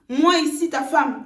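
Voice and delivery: normal talking voice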